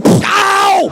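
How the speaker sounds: loud high yelp of pain; mock sudden yelp. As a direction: cry out as if stabbed. Needle stab.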